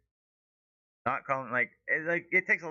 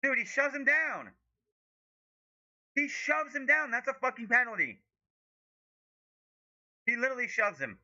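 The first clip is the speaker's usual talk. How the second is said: furious disbelief; 13 semitones higher than usual. Real, not acted. Fan outraged at a shove on screen, very high incredulous cry. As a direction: wail it like you can't believe what you saw.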